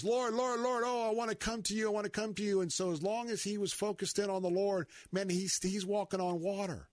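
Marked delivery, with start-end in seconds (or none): 0.0-2.6 high, fast, pleading calls; desperate pleading. Retold or imitated. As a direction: cry out eagerly to the Lord. Peter calling to Jesus.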